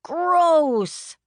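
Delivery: put-on high, loud disgusted little boy; boy bursting out. A narrator's reading. Boy disgusted at his sister's accident, loud high burst. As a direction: blurt it like a grossed-out kid. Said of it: "Flick burst out".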